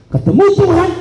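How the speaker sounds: high-pitched preacher's proclamation; emphatic preaching. Acted. Preacher teaching the congregation the order of worship, emphatic and high.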